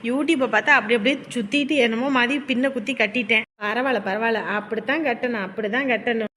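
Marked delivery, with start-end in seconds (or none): none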